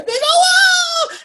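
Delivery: loud, high celebratory "ooh"; mock islander cheer; crowd whoop. Islanders cheering in retold scene.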